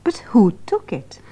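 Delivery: hushed, secretive whisper. Acted. Whispering the mystery to the listeners. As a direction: breathe it like a secret. Conspiratorial question about missing book.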